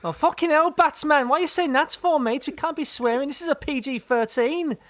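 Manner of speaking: high-pitched, put-on Scouse protest; Scouse Inspector Gordon. Gordon telling Batman off for swearing, in high mock Scouse. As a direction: do a dodgy high Scouse accent, scolding a mate. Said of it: "he's a Scouse".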